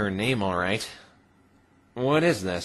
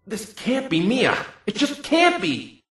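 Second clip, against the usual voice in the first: disbelief; 10 semitones higher, slower than usual. Acted. Disbelief at identity.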